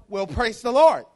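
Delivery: loud, hearty mock church greeting; church lingo. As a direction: imitate a churchgoer proclaiming it loudly. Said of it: "we have a different lingo".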